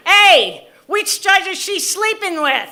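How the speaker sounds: imitated mother's high, loud heckle; mock outraged yell; retold yell. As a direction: holler it from the audience, pitched high. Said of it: "And she yelled".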